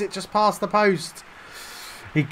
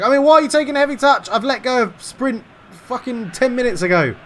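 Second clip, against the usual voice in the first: higher, louder, fast exasperated rant; real rage; 8 semitones higher, 5 dB louder than usual. Real, not acted. Gamer ranting at his own striker, furious and fast. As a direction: spit it out, fed up with your own player. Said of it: "I'm getting so angry".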